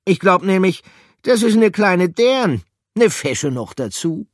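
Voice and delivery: very high, loud, charmed; admiring character voice. Acted. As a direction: gush over the pretty mouse.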